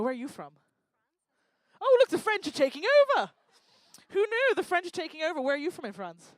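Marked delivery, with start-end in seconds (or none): 1.8-5.4 loud, high mock outcry; hammed-up alarm, pitched high. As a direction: announce a takeover in exaggerated dismay. Comic mock alarm at audience.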